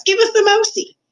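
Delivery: very high, loud rat voice; shouted demand. A narrator's reading. Hungry rat wanting the mouse, shouting shrilly. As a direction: demand it in a high-pitched yell. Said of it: "shouted one of the rats".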